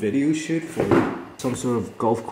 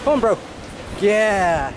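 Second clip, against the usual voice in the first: higher, eager pep talk to the dog; hyped-up encouragement; 7 semitones higher than usual. Real, not acted. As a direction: cheer him on like a coach.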